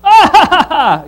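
high, loud hammed-up laugh; mock laugh. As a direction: cackle as if teasing the kid. Mocking a kid named Laughter.

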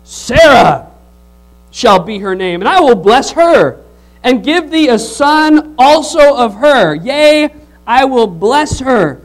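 loud, slow scripture proclamation; fiery preaching. Acted. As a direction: build the promise with rising fire. Reading scripture, preaching at full force.

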